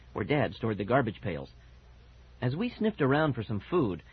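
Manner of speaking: usual speaking voice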